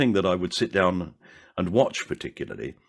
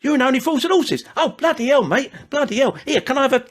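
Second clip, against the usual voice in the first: much higher and louder gushing, tumbling out; high, loud, breathless fan gushing over the actor; overexcited fan; 5 dB louder than usual. Retold or imitated. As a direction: play a starstruck fan who can't contain himself. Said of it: "they go".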